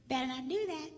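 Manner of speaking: high wifely warning, lightly voiced; mock wife warning. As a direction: play the wife cautioning him. Wife's warning in retold exchange.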